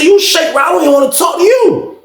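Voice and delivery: high-pitched dismissal; retold scorn. Inmate refuses to talk to a shake-rattle-roller, retold scorn. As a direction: brush him off in a high, heated voice. Acted quarrel, insulting the officer.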